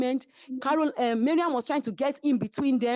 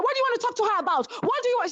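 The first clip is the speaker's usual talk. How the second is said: mock angry challenge; 13 semitones higher, much faster than usual. Chioma snapping at Caroline.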